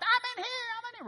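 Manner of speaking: very high, strange calling out; squeaky high call from the closet; Ben's high voice. Retold or imitated. Ben calls out from the dark closet, very high and strange. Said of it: "It's kinda how he talked".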